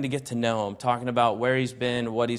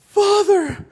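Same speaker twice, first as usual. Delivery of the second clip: high, loud, breaking cry of Father; overwhelmed driver crying out to God, tearful; 9 dB louder than usual. Retold or imitated. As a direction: call out to God through tears. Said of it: "I was just crying, bawling my eyes out".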